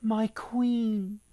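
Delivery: bow to the queen with a lifted voice; priest's wife. Acted.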